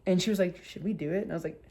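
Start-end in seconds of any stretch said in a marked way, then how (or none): 0.6-1.2 tentative. Retold or imitated. Mother sounding out her daughter, tentative and quiet. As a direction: float the question quietly.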